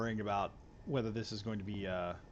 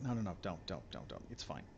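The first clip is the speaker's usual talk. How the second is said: hushed no no; 4 semitones lower, 10 dB quieter than usual. Retold or imitated. Reassuring viewers not to donate.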